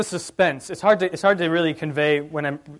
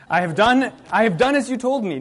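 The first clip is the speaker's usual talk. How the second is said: higher, louder, over-insistent protest; overcompensating; 5 semitones higher, 4 dB louder than usual. Acted. Jacob trying to fool Isaac, loudly overcompensating. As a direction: oversell it, pushing "done" hard. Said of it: "he's overcompensating".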